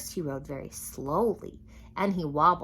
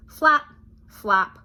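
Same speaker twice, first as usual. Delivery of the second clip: bouncy sound effect; 4 semitones higher, 11 dB louder than usual. A narrator's reading. Sound words of leaping.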